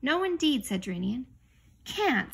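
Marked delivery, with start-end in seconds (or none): 0.0-0.6 higher, louder refusal; firm objection. A narrator's reading. Captain backs objection to the king, emphatic and loud. 1.8-2.3 high, loud sharp retort. A narrator's reading. King challenged by his crew, retorts sharply. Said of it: "said Caspian sharply".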